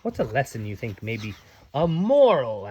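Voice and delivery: ordinary delivery, nothing marked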